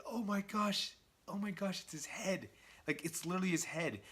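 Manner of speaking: high, softened voice of dismay; mock horror. Reader reacting to the head in the bag, mock horror.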